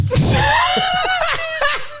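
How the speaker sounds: a big burst of high laughter; shrieking laugh. Real, not acted. Laughing at own porn mag story.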